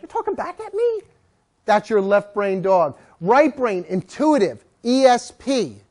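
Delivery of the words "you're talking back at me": much higher, put-on incredulous owner; mock disbelief. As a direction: shoot up in pitch, offended and amazed. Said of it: "And you're like".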